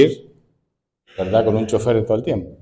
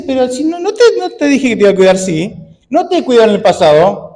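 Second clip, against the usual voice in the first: much higher, louder playful voice of God; God teasing the anxious believer, high and playful; voicing God; 11 semitones higher, 7 dB louder than usual. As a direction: play God gently scolding a worrier. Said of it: "y Dios dice".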